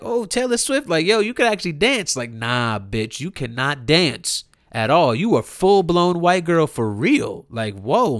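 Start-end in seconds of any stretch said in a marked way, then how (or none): 0.0-2.2 lightly mocking higher-pitched admiration; imagined impressed fan. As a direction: feign being impressed by her dancing. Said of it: "it's not like".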